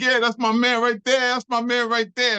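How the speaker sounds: high, proud hype; acted-out excited boasting; cheering friends. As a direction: shout out your boy from the crowd. Friends cheering his win.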